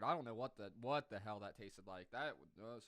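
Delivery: ordinary delivery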